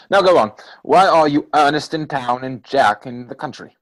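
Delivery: a loud teasing insistence; pressing demand. Acted. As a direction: demand the answer, loud and eager. Pressing his friend for answers.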